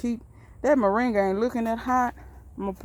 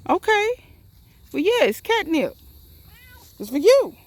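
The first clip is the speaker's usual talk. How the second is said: high, loud coaxing pet voice; calling the cat; 8 semitones higher, 7 dB louder than usual. Pretend. Gardener coaxes the cat toward the catnip, loud and high. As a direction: coax the cat over, bright and raised.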